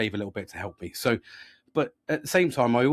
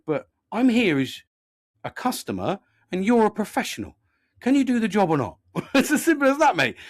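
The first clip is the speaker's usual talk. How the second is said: raised, indignant customer's retort; grumpy challenge; 8 semitones higher, 4 dB louder than usual. Retold or imitated. Customer challenging a professional mechanic, grumpy and insistent. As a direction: push the voice up and demand an answer. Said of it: "I got a bit grumpy with him as well".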